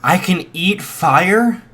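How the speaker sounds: put-on a boy stunned to hear he can eat fire; excited shout. Izuku asking his teacher in amazement, mild shout. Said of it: "Izuku shouted out".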